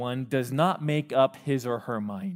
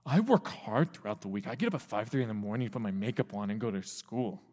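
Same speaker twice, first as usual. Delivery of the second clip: lower, softer, fast protesting; defensive teen; 5 semitones lower, 6 dB quieter than usual. Retold or imitated. Teen answering parents' jab, low and hurried. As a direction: mumble it defensively. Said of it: "And you're like".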